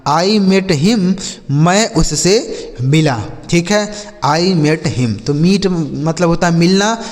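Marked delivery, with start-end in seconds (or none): 0.1-1.1 each word said very slowly and clearly; slow clear English. Shown as a demonstration. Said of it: "जैसे हम बोल सकते हैं कि". 4.2-5.2 each word said very slowly and clearly; slow clear English. Shown as a demonstration. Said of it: "जैसे हम बोल सकते हैं कि".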